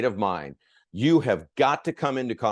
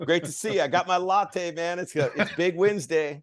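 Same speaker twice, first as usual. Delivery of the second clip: high, loud, chummy dad with a latte, laughing; playing the client; 8 semitones higher, 4 dB louder than usual. Trainer playing a client greeting his friend Mike, high and hearty. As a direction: greet a school-run buddy with big cheer. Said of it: "I'm gonna start off as the, uh, the client".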